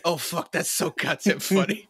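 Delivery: slow, drawn-out film guy voice. Retold or imitated. Retelling a stranger's outburst in a slow put-on voice. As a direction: mimic the guy who laughed loudest. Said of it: "And it's like".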